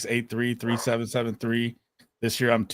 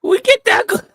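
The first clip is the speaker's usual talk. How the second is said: very high, loud hammed-up shriek, cut short; high-energy mock yell; 12 dB louder than usual. Co-host answers the cue to bring energy, high mock yell. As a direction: blast it out in a put-on high voice. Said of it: "And bring the fucking energy".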